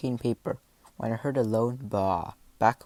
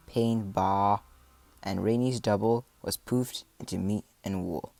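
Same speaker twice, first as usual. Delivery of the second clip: usual speaking voice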